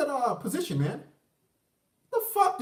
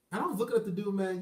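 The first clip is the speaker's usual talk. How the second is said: ordinary delivery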